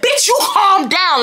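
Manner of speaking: high, drawn-out re-enacted comeback; heated mock retort; angry retort. Own retort in acted quarrel.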